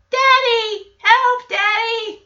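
put-on high child's voice calling out for Daddy; little kid calling. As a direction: play a small child crying out from the crib. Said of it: "we hear the baby monitor".

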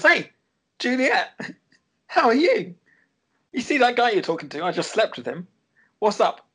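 high, giggly, falsely sweet greeting; mock-cheerful rival rubbing it in; catty Scarlett impression. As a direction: gloat sweetly with a laugh in it. Mocking Scarlett's gloating greeting.

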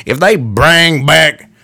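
put-on slow, drawn-out Southern American accent. Vlogger slipping into a Southern accent mid-thought, slow Southern drawl. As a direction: slip into a Southern drawl on the words. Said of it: "I just automatically went Southern on that".

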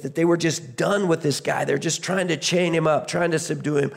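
ordinary delivery

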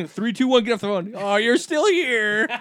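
loud high countdown, then fake surprise; excited countdown. Acting phone hangup game.

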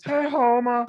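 high, loud slurred barfly greeting; town drunk. Retold or imitated. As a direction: call out like the Simpsons barfly. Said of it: "the town drunk on, uh, Simpsons".

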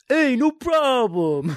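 a lightly done nasal-free Rappaport, stretched out; Michael Rappaport impression. Friend waiting outside prison, high slow imitation. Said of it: "Rappaport's like".

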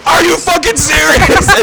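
high, loud, slow disbelieving shout; outraged yell. Retold or imitated. Retelling yelling at the cops.

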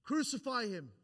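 high, loud cry imitating a mob; imitated crowd yell. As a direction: call it out like the yelling crowd. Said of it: "that was yelling".

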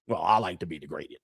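understated businesslike delivery; mock professional. Comic imitating the woman in the video, mock-professional. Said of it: "she said it all professionally".

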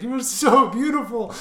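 high, loud, overcome gushing; crazy man's adoration. Retold or imitated. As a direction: gush it like a lovestruck madman. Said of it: "it was this crazy man".

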